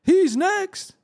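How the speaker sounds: high, slow, hammed-up gossip chant; mock the people who want him to fall. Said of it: "you got people saying".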